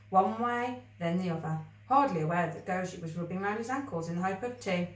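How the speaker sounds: ordinary delivery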